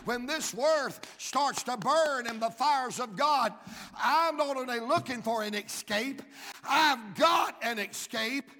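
building from firm preaching to a high, loud crying shout; fiery preacher rising into a loud cry; preaching at full force. Acted. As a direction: climb to a shouting cry on the escape.

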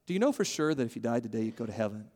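quiet earnest repeat; soft sincere question repeated. Shown as a demonstration. Preacher repeating the question to a friend softly. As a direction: ask it again, just as soft. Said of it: "But to ask someone is to say".

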